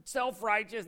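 slow indignant jab at the preacher; mock offended sneer. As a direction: sneer it like someone who feels judged. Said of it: "They get all mad at me".

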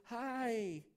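high, put-on sweet phone voice; mock-cheery wife on the phone; sudden sweet switch. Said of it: "you ever see your wives do that".

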